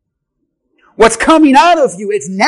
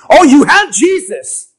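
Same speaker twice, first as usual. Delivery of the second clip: mock exclamation; 4 semitones higher, 6 dB louder than usual. Mocking the sour believer.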